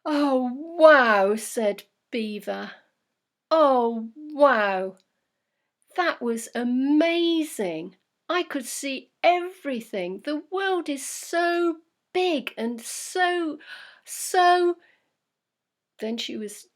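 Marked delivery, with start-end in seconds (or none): 0.1-1.3 much louder, delighted gasp of wonder; breathless excitement. Acted. Beaver just landed, breathless with excitement. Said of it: "breathless with excitement". 3.5-14.8 slow, much louder and higher wonder; overwhelmed, searching for words; awestruck excitement. Acted. Beaver after the flight, awestruck and breathless. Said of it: "breathless with excitement".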